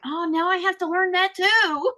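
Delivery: high-pitched playful whine; hammed-up exasperation, pitched high; mock complaint. Mock complaint quoting her own reaction.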